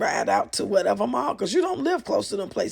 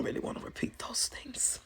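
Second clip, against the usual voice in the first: whisper; 12 dB quieter than usual. Real, not acted. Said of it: "declines to repeat cussing".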